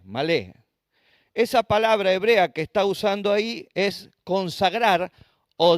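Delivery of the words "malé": malé, weight on the closing "lé"; stress on final E. Shown as a demonstration. Preacher repeats the Hebrew word, malé stressed on the E. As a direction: stress the last syllable of malé, pitch dropping. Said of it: "acento en la E".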